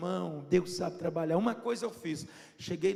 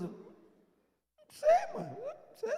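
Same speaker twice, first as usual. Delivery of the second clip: very high, laughing "não sei"; playing dumb. Son fending off his mother, squeaky and laughing. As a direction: squeak it out like a sheepish kid.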